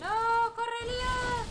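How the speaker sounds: high, loud urging yell; urgent shout. Real, not acted. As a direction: yell at him to run. Urging teammate to run.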